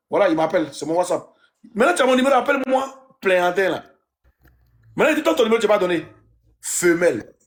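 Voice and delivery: usual speaking voice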